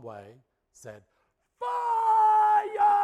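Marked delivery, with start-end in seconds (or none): 1.6-3.0 full-throated panicked scream, much higher and louder; screamed fire. Retold or imitated. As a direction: yell the one word at the phone in a panic. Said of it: "when I finished screaming".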